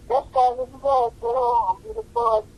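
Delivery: comic high-voiced impression; high mock voice. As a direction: play him up high and proud. A&R head voiced.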